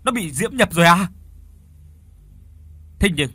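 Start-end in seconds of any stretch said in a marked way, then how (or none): 0.0-1.1 stammered, pitched up, growing louder; trembling fear. A narrator's reading. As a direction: stammer out the question in rising alarm. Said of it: "Đức run lên".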